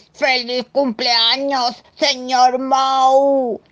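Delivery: grito lento, agudo y fuerte; canturréalo a gritos, muy despacio; gritando. Acted. (grito festivo y alargado)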